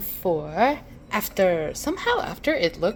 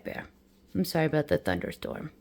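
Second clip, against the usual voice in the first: ordinary talk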